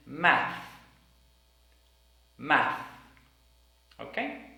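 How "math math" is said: Math ending in th; Math, tongue out at the end; careful final th. Shown as a demonstration. Teacher drilling the word Math.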